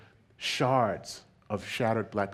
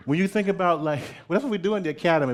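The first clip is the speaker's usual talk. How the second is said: quick, high, laughing rush; laughing aside; 8 semitones higher, 6 dB louder, faster than usual. Real, not acted. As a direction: rattle it off through a laugh.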